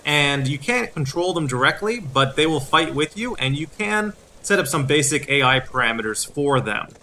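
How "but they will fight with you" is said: ordinary delivery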